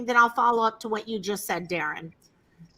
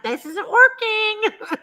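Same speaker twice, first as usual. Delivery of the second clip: mock whine; 9 semitones higher, 4 dB louder, slower than usual. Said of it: "and I'm like".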